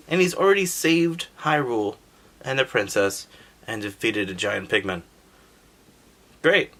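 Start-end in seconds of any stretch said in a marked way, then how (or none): none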